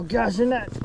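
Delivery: ordinary delivery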